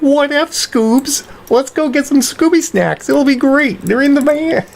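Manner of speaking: put-on high, loose Shaggy voice as a girl. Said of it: "If Shaggy were a girl".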